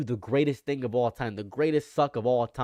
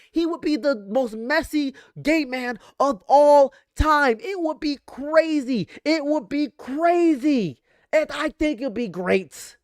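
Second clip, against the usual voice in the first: camp excitement; 10 semitones higher than usual. Real, not acted.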